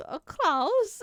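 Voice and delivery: high, loud try at a German accent; attempted German accent. Shown as a demonstration. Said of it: "don't know how to do a German accent".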